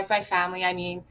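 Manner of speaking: usual speaking voice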